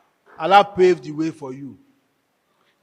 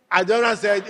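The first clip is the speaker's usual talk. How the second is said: normal talking voice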